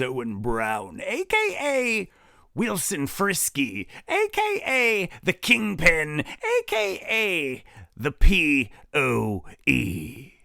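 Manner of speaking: high, drawn-out announcer voice; showman announcer. Acted. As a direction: introduce him like a wrestler. Host hands over, drawn-out aliases.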